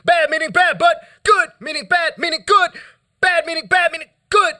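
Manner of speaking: spoken-word poem with moans between lines; poetry performance. Acted. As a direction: preach the words, then hum.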